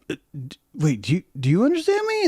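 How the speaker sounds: high, fast amazed ask; astonished question. Retold or imitated. Bird amazed it was understood, fast high astonishment.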